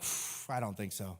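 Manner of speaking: whispered breath then low, soft disbelief; a soft skeptical scoff; doubting listener. Retold or imitated. Listener doubting an angry Jesus, soft low scoff. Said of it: "maybe you're just thinking, like".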